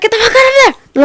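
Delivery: high, loud panicked yell; yelling a warning. Retold or imitated. Friend warning others off the train tracks, yelling.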